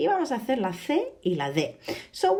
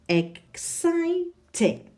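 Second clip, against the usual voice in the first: exciting drawn out slowly, CI pitched high; teacher modelling exciting, high and very slow; stress on second syllable; 10 semitones higher, much slower than usual. Shown as a demonstration. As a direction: stretch ex-CI-ting slowly and lift the middle. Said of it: "Is the second syllable".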